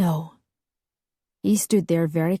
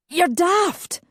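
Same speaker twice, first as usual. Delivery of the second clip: desperate; 12 semitones higher than usual. Acted. Desperate cry at brother.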